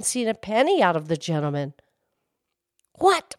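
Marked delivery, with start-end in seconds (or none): 2.9-3.3 high, loud outraged shout. Acted. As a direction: explode with fake outrage. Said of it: "Falsehood shouted".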